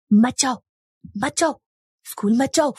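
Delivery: put-on mad girl blocking the way; urgent, insistent warning in a crazed girl's voice; mad schoolgirl. A narrator's reading. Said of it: "वो पागल लड़की फिर से".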